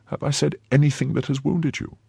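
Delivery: put-on gentle young woman voice; novice voice. A narrator's reading.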